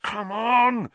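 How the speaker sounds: urging growl, high. A narrator's reading. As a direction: growl at the broom. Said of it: "he growled at his nimbus".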